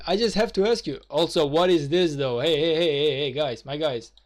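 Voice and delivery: ordinary talk, nothing marked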